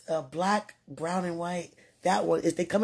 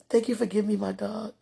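ordinary talk, nothing marked

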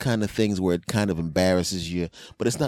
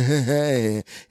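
chuckle; 6 semitones higher, 4 dB louder than usual. Real, not acted. Laughing at own story.

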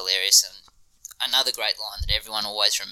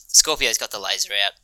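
usual speaking voice

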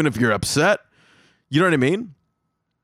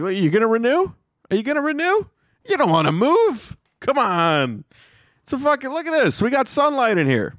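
much higher salesy coaxing voice; pushy landlord pitch; 9 semitones higher than usual. Retold or imitated. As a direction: sell the apartment eagerly. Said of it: "I'd probably be like".

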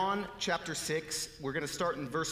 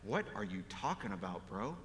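incredulous aside; 8 semitones lower, 6 dB quieter than usual. Retold or imitated. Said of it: "look at him cross-eyed like".

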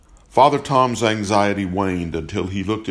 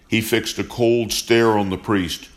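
ordinary delivery, nothing marked

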